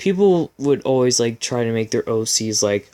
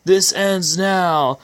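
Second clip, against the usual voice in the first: slow dramatic hero line; 6 semitones higher, much slower than usual. Acted. Hero faces Discord.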